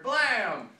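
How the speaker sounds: loud, drawn-out blam; imitated gunshot. Storyteller imitating the shot that hit him, loud mock bang. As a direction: punch out a mock gun blast.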